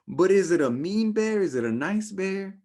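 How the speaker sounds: hammed-up puzzled voice; mock pondering. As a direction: overplay the dithering. Mocks own dithering.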